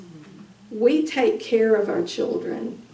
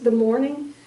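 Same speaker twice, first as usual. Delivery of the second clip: normal talking voice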